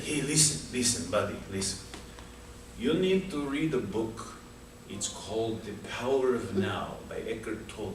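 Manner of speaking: put-on a light Russian accent giving advice. Russian guy earnestly recommending a book, in a light Russian accent. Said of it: "talking in Russian".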